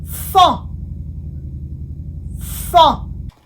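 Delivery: "Fin" in French, nasal vowel, no final n; careful French pronunciation. Shown as a demonstration. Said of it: "Now that we have seen the sound O".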